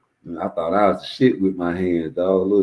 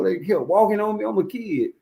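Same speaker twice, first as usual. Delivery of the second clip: ordinary talk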